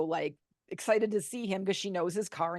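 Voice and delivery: normal talking voice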